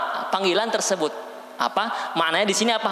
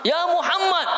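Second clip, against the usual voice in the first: shouting the name; 11 semitones higher than usual. Retold or imitated. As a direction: shout his name rudely. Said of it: "teriak-teriak".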